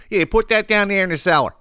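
high put-on Philly Kensington accent; imitating Kenzo relative. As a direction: play an old Kenzo relative talking about the cellar.

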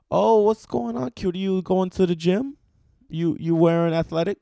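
camp it up as the sweet guy with a crush; flirty deli guy. Retold or imitated. Deli clerk flirting with the comedian as he walks in, high camp imitation. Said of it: "he talk a little bit like this".

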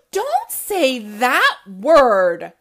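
comic refrain shout. A narrator's reading. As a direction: belt the refrain like a joke punchline. Refrain after gasp.

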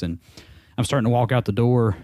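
ordinary delivery

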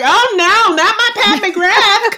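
hammed-up horror at losing her lipstick; mock dismay.

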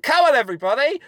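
mock rallying call. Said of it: "I went".

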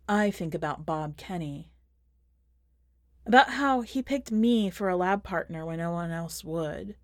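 ordinary delivery

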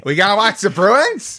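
high Boston woman impression; nasal-free Boston accent, high pitched. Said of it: "Boston accent impression".

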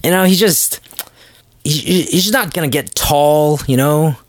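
high, slow put-on fatherly voice; sneaky father. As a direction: drag it out like a sly dad talking his daughter down. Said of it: "It's like".

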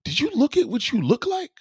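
high, rising outraged disbelief; high disbelief at a friend's choice; incredulous. Retold or imitated. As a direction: scold her for what she says. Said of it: "And I'm like".

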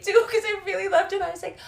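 ordinary talk, nothing marked

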